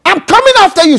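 high, loud defiant declaration; teaching the church to defy Satan; bold declaration. Shown as a demonstration. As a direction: declare it to the devil's face. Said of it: "Say that".

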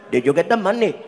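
put-on questioning Indian-accented getaway partner. Getaway partner to the returning robber, Indian accent. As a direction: ask expectantly in an Indian accent.

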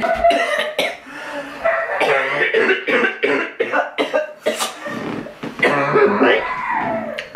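sudden high choking cough; coughing fit. Real, not acted. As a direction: choke and cough hard on a breath. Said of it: "I just started choking".

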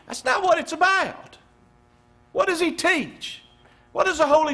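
loud, high heated pulpit rebuke; fiery sermon rebuke; preaching at full force. Real, not acted. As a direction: scold the congregation's easy slogans.

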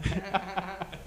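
short burst of laughter; amused laugh. Real, not acted. Host reacts to guest's hello, amused laugh.

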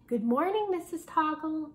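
put-on bright chorus of kids greeting their teacher; sing-song classroom hello; best morning voice. A narrator's reading. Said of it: "in their best morning voices".

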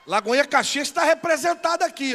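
shouted announcement to the hall; calling out. Real, not acted. Pastor greets visitors from Caxias with a loud shout. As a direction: raise the voice and hail the Caxias group.

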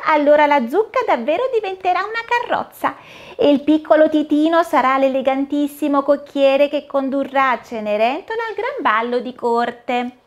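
high, bright-eyed fairy godmother, cheerful and busy; the fairy godmother greets Cinderella at the pumpkin, high and cheery. Acted. Fairy explains the magic.

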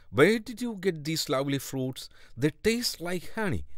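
the wife exclaims in wonder at the fruit; a crocodile wife gushing over sweet fruit; exclaiming wife. A narrator's reading. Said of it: "the crocodile's wife exclaimed".

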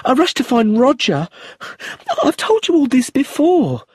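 frightened, tearful widow. Acted. Widow retelling the death to the inspector, high and panicked. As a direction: rush through it on the edge of tears. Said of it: "she looked frightened".

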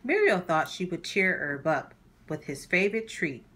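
plain reading voice

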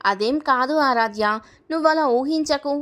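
plain reading voice

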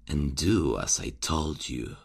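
usual speaking voice

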